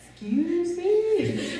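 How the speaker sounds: high, drawn-out mock offence; indignant disbelief. Girl to rude kid behind her on the stairs, indignant disbelief. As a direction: stretch it slowly, imitating an affronted reply.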